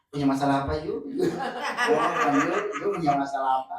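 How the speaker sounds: loud mock questioning, breaking into laughter; how not to talk. Shown as a demonstration. Trainer showing trainees how not to address Yu, loud and joking. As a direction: blurt the question loudly, half laughing. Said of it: "ya jangan gini ngomong".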